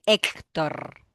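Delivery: ordinary talk, nothing marked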